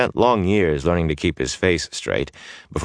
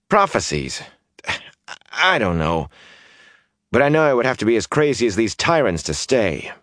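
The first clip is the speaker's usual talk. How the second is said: put-on higher, eager young rogue voice; brash young man; 5 semitones higher than usual. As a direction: restless youth pleading to leave. Mat's voice again.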